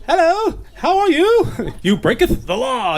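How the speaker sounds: hammed-up high olde English movie voice; pompous stage Englishman, a Bible character in a movie; mock English accent. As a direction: put on a fake British period-film accent. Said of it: "movies we've seen with the English accents".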